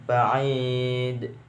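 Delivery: "Ba'id" with a long, held ii before the d; mad arid lissukun. Shown as a demonstration. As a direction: draw out the "i" in "Ba'id". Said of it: "mad arid lis sukun". (baca "Ba'id" dengan i dipanjangkan)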